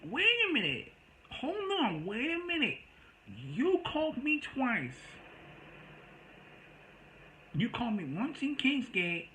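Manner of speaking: high-pitched, drawn-out indignation; retold shocked protest. Correcting the caller who blamed him, high indignant protest. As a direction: protest slowly in a squealed-up pitch. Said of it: "I was shocked".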